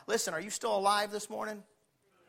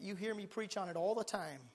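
usual speaking voice

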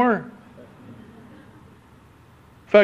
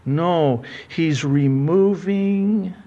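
ordinary talk, nothing marked

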